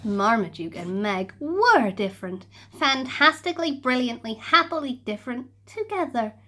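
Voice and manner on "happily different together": usual speaking voice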